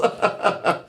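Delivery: a quick real burst of laughter; short laugh. Real, not acted. Laughing at his own joke about being equally stuck.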